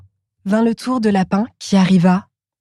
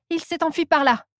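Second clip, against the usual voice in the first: Rabbit's voice; 8 semitones higher, 5 dB quieter than usual. Acted. Rabbit points the way.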